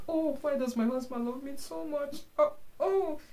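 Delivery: high-pitched pretend weepy wail; mock crying. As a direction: wail it in hammed-up tearful disbelief. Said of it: "You can just start crying like".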